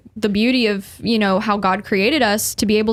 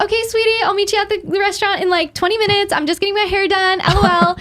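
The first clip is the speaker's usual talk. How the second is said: high, sugary mock girly voice; sweet texting woman; 9 semitones higher than usual. A woman texting her date, chirping in high sugary mock voice. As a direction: chirp it like a bubbly girlfriend. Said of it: "we'll be like".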